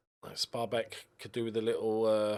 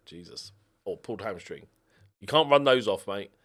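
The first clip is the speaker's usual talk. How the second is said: ordinary talk, nothing marked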